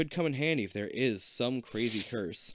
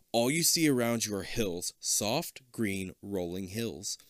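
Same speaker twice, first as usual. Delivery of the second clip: normal talking voice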